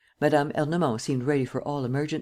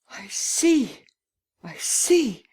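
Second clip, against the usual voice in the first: put-on breathless, much higher, shocked old lady; gasping in dismay; 8 semitones higher, much slower than usual. A narrator's reading. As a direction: gasp it out in sudden dismay. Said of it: "She looked at him in dismay and gasped".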